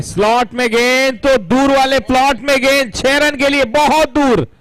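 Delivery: high, loud, thrilled six call; excited shout. Real, not acted. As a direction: yell it as the ball sails out. Commentator calls a huge six.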